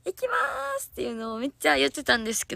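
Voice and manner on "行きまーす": high, drawn-out stage call, performed; idol call. Retold or imitated. Idol recreating her recorded catchphrase as a high stage call. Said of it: "大声で言ってたんですよ".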